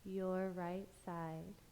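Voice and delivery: slow, soft meditation voice with long pauses; guided meditation. Acted. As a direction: lull them through a body scan, phrase by unhurried phrase. Leads guided body-scan meditation.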